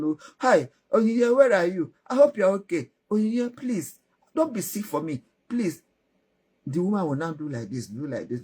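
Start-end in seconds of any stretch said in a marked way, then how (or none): 0.0-5.8 higher, louder fretful husband calling his wife; acted doting concern, pitched up; worried pleading. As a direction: plead anxiously with a sick sweetheart.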